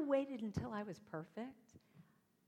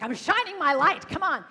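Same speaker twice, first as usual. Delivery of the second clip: louder, higher triumphant boast; triumphant cheer; 6 semitones higher, 15 dB louder than usual. Retold or imitated. Speaker cheering her own kindness, loud and triumphant. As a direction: crow about the good deed.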